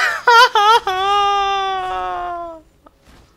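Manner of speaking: long, high, loud wail of laughter; screamed cackle. Real, not acted. Gamer gloating after a kill, screamed cackle.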